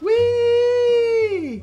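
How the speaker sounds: very high, long squeal; gleeful whee. Pretend. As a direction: squeal all the way down. Dives off windowsill.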